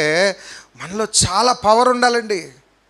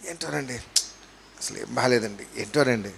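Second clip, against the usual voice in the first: very quiet, low mock grumble; put-on weary complaint, sunk low and soft; imitating destitute complainer; 8 semitones lower, 10 dB quieter than usual. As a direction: act the hard-done-by moaner, barely audible. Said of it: "tent వేసుకొని బతుకుతున్నోళ్ళలా మాట్లాడుతుంటారు".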